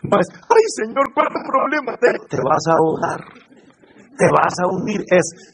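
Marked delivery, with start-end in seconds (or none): 0.3-2.2 high, quick complaining prayer; mock lament. Preacher imitating a complainer praying to God, mock lament. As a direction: wail lightly in mock distress.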